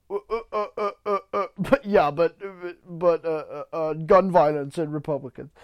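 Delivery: mock stammering, a string of repeated uhs before the answer; imitated flustered comeback. Host mimics Trevor Noah fumbling a comeback, mock stammering. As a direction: stumble and restart, imitating someone with no comeback. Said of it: "He probably would have said".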